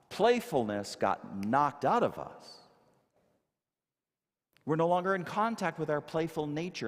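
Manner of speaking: ordinary talk, nothing marked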